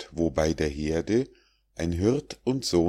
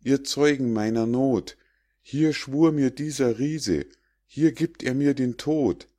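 higher, frightened, pleading outcry; fearful cry; 7 semitones higher than usual. A narrator's reading. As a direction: call the woods to witness in fear. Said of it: "schrie er". (hoch und angstvoll klagend gerufen)

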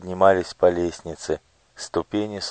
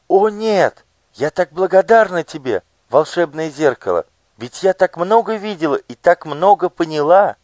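put-on higher, louder heartfelt young girl; grateful girl voice; 10 semitones higher, 5 dB louder than usual. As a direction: thank the mirror with girlish fervour. Olya thanks the mirror.

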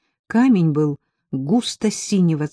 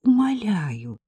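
high, drawn-out begging; pleading; 7 semitones higher, 4 dB louder, slower than usual. A narrator's reading. Ella implores her friend to deliver the pin, pleading. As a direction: wheedle and implore. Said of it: "упрашивала Элла".

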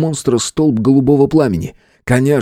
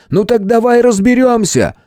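high, raised-voice challenge; battle challenge; 13 semitones higher than usual. Acted. Fighter calls the monster out to fight, defiant. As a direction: call the enemy out, pitched high.

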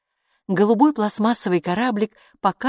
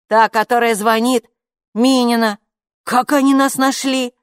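raised, loud reporting voice; shouting back; 6 semitones higher, 6 dB louder than usual. A narrator's reading. Oskar tells Kaapo who is ringing, loud excited call. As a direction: keep calling it out to the other room. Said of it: "крикнул в ответ Оскар".

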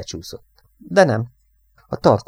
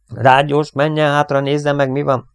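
loud, raised command; giving orders; 6 semitones higher, 6 dB louder than usual. A narrator's reading. Pilot sends radioman to check on gunner, ordering. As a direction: issue it as an urgent order. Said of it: "utasította Dannyt".

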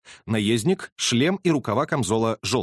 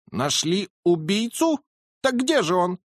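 higher, stunned question; astonished colonel; 7 semitones higher than usual. Acted. Colonel amazed the killer is found, higher. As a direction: gasp in disbelief.

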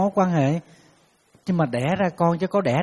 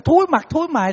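much higher, shrill-pitched mock scolding; scolding mother; 11 semitones higher, 4 dB louder than usual. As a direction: wail it like an outraged old mother. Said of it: "bà nói".

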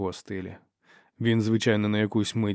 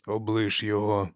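ordinary delivery

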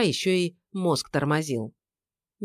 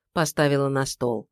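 ordinary delivery

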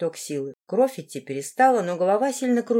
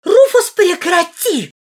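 high, loud, drawn-out squeal; shriek; 10 semitones higher, 10 dB louder, slower than usual. A narrator's reading. Сильвана begging Руфус to stop, shrieking. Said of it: "взвизгнула она".

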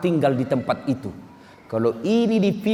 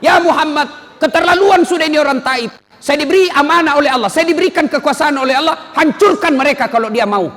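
higher, louder, fiercely urgent offer; Jibril's fierce call; 8 semitones higher, 5 dB louder than usual. Acted. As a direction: thunder it as the angry angel. Jibril angrily offers to destroy Taif. (Jibril berseru keras dan marah)

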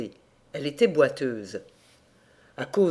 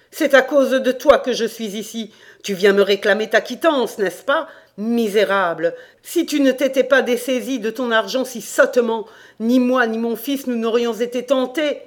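loud, raised tirade of blame; angry shouting; 5 semitones higher, 6 dB louder than usual. Acted. Damned landlord blaming his farmer for his fate, angry shouting. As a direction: shout bitter reproaches at the culprit. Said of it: "Tout en criant ainsi".

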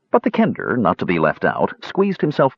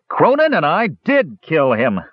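high, slow insistence; loud tipsy boasting; 7 semitones higher than usual. A narrator's reading. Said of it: "was talking loudly".

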